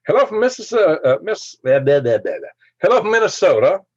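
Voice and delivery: high, loud mock-cheery hello; bright overblown welcome from the chat; sing-song greeting. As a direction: greet them like a game-show host. Reading a chat greeting aloud.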